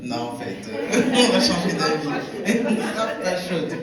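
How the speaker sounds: laughing. Retold or imitated. Playful quote while laughing.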